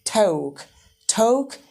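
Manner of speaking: "talk" with a long O, like "toke"; British "talk". Shown as a demonstration. Teacher drilling British English, "talk" with O. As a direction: repeat "talk" with a rounded O vowel. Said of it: "in British English as talk".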